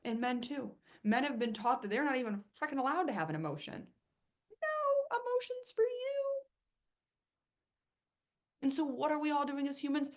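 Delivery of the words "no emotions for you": high, slow mocking voice; mock stern voice. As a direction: parrot the scolding rule, high and slow. Mocking society's message to men.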